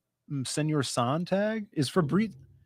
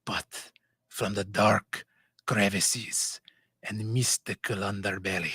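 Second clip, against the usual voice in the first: put-on sneaky Middle Eastern narrator accent; 5 semitones lower than usual. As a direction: intone a dark tale in a Middle Eastern accent, dropping toward a whisper. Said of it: "sneaky Middle Eastern who snuck into France".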